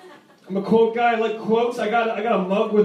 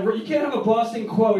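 usual speaking voice

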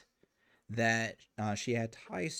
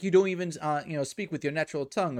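higher, louder mock reproach; lightly acted scolding, pitched up; imitated parental scolding; 5 semitones higher, 5 dB louder, faster than usual. Parent scolding at the table in that scene, mock reproach. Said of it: "They could have easily said".

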